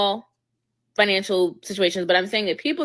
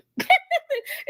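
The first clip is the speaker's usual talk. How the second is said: laughter. Real, not acted. Co-host laughs at the quote.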